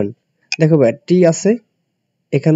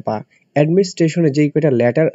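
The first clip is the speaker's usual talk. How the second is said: usual speaking voice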